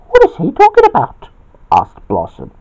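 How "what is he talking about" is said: very high, quick question; Blossom's high voice. A narrator's reading. Blossom asks about Bobby's murmuring, very high voice. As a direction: squeak it out, puzzled.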